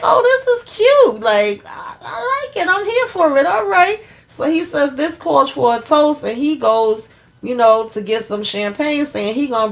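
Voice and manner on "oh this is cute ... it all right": delighted squeal. Real, not acted. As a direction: coo it like a thrilled fan. Own delighted reaction.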